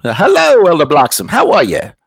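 put-on Southern accent. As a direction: imitate a hearty Southern mission president on the phone. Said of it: "he's got a very Southern accent".

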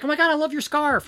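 high, bubbly mock compliment; imitating gushing receptionist. Imitating the receptionist praising her scarf, high and gushing. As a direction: pitch it way up and gush. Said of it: "And so she's like".

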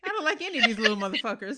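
loud, quick mock confession; fast, loud joke in character; mock blunt mother. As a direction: blurt it loudly as a joking impersonation. Joking as the mother, over laughter.

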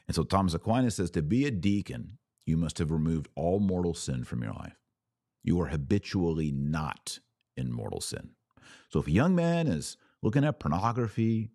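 ordinary talk, nothing marked

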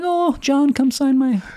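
high, breathy-free squealing fangirl plea; gushing fan. Pretend. Fan begging the director for an autograph, high and gushing.

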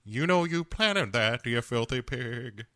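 hammed-up country old-timer; Uncle Jesse. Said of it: "Uncle Jesse says".

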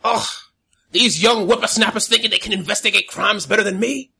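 put-on high, loud grumpy old codger; old man voice. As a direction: grumble like a cranky old man. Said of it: "you hear old man's like".

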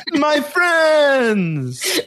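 high, stretched-out hail to friends; hammed-up, high-pitched welcome; exuberant greeting. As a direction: imitate an overjoyed host greeting pals. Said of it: "And he's like".

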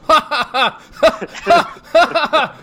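loud, high forced ha-ha laugh; high-pitched mock guffaw, ha by ha; fake laugh. Shown as a demonstration. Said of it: "that's my fake laugh".

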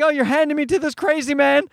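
high, pleading mock panic; hammed-up frightened child; terrified child. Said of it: "She's like".

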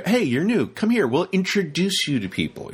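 high, welcoming volunteer; bright hello to a newcomer; eager greeter voice. Retold or imitated. Voicing friendly event staff.

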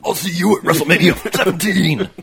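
loud wrestler hype; wrestling promo. Acted. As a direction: promo shout into the camera. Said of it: "every wrestling promo since nineteen eighty".

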